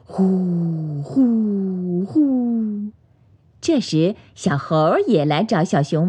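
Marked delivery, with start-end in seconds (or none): 0.0-2.9 long, blown-out sleeping puffs; pretend snoring. A narrator's reading. Bear falls asleep again, long pretend snores. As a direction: snore in slow, storybook whoos. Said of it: "这次还打起了呼噜".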